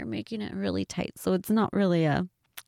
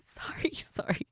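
whispered apology; 2 semitones higher, 8 dB quieter than usual. Real, not acted.

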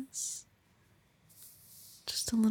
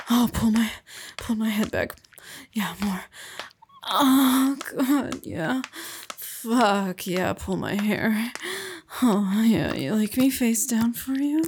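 loud moaning; 5 dB louder than usual. Acted. Moaning in climax.

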